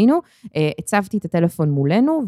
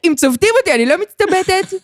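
mock boast; 10 semitones higher than usual. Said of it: "joking self-boast".

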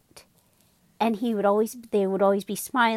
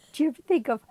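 lightly voiced sad cereal; 5 semitones higher than usual. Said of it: "he goes".

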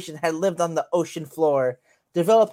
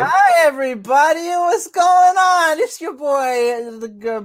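hyped host voice; 9 semitones higher, 7 dB louder than usual. Acted. Host intro in put-on voice.

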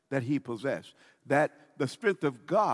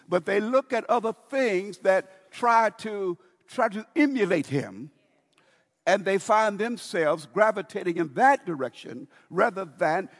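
higher and louder, pressing each phrase home; preaching at full force; 6 semitones higher, 6 dB louder than usual. Acted. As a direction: climb into a fervent sermon peak.

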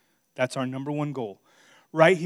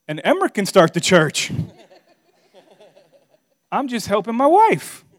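joking through a laugh, higher and louder; 8 semitones higher, 9 dB louder than usual. Real, not acted. Husband joking to the congregation, laughing. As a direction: laugh while delivering the punchline.

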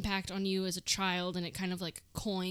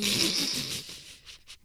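wheezing laugh; 4 semitones higher than usual. Real, not acted. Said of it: "can't be doing the wheeze laugh now".

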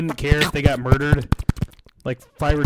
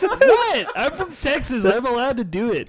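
indignant; 8 semitones higher than usual. Real, not acted. Defending himself, high voice.

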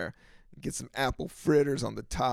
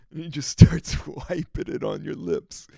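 laughing whisper; 8 dB louder than usual. Real, not acted. Laughing through the story.

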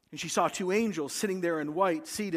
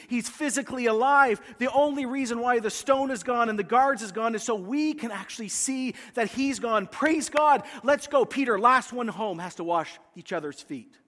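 John urging Peter to race home, excited; joyful excitement; 4 semitones higher than usual. Retold or imitated. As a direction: cheer him on, ready to run. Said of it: "Can't you hear him talking to Peter?".

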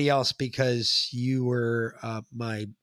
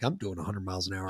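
hushed shock; 6 semitones lower, 11 dB quieter, faster than usual. Retold or imitated. Dad shocked at car's speed.

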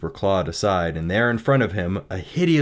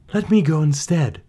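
put-on young woman voice; 10 semitones higher, 6 dB louder than usual. Beauty volunteers.

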